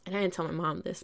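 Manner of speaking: ordinary talk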